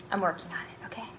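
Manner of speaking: whispered sheepish confession. Real, not acted. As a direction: admit it under your breath. Sheepish aside about swearing.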